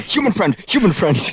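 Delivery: Orcish repetition. Acted. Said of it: "He repeats in Orcish".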